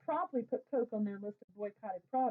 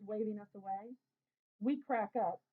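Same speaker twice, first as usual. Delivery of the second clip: ordinary delivery, nothing marked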